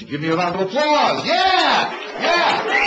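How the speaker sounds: loud, high call to the crowd; yelling for applause, pitch soaring; rousing shout. Real, not acted. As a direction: whip the audience up, shouting. Cheering the audience to applaud.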